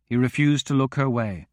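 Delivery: plain reading voice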